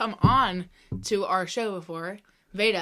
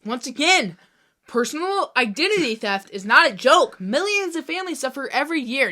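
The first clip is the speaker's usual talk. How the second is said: loud, high, emphatic indignation; Dwight's outraged rant; 5 semitones higher, 9 dB louder than usual. Acted. Host repeats the show's rant at co-host, outraged. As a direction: declaim it in hot protest. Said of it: "that's a quote from our favorite T V show".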